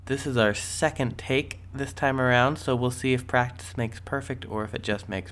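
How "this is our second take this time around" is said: ordinary delivery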